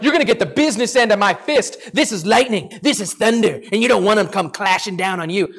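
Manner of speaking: lightly voiced bragging bully; a hint of a showy brawler making threats; mock tough guy. Preacher voicing leaders threatening Peter, lightly mock tough.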